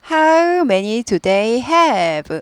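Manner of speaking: very slow, end drops; slow teacher model, falling end; falling tune. Shown as a demonstration. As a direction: say "How many do they have?" and let it fall. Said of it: "의문사 있는 의문은 끝이 내려갑니다".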